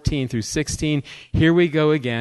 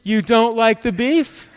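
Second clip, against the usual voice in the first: higher, drawn-out wounded question; guilt-tripping mother; 7 semitones higher, 4 dB louder, slower than usual. Retold or imitated. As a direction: imitate a mother laying on guilt. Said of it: "and my mother says".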